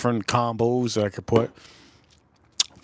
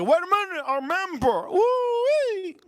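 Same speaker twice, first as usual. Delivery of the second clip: excited squeal. Acted. Excited over a new member.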